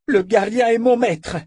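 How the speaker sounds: loud, high-pitched outburst; furious cry. A narrator's reading. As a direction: cry it out in fierce devotion. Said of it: "cria la sœur de l'obscurité".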